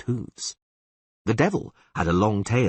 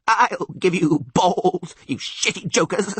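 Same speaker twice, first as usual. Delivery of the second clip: high, loud, drawn-out screech; screaming goat; 11 semitones higher, 4 dB louder, slower than usual. A narrator's reading. Goat horn attacks the jokers, screaming. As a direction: scream threats in a bleating goat voice. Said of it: "screamed the goat horn".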